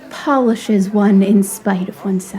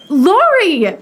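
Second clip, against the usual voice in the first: higher, louder glad cry of his name; delighted call; 8 semitones higher, 4 dB louder than usual. Acted. Amy spotting Lori across the room, delighted call. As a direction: call out to him with joy.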